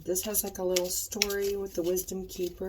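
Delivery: usual speaking voice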